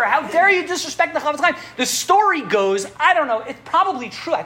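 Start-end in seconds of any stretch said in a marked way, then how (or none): 0.0-1.6 mild mock anger. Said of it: "worked up into a lather of anger".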